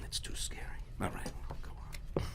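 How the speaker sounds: ordinary delivery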